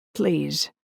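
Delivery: firm, falling command. Acted. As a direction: say it like an order. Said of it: "I sounded more commanding than I felt".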